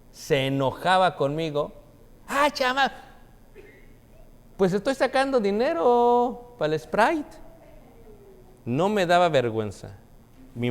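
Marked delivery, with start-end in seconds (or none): 2.3-7.4 put-on higher voice of a cheeky boy talking back; child caught, talking back brightly; cheeky kid reply. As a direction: answer the angry lady like a sassy kid. Child answering scolding lady.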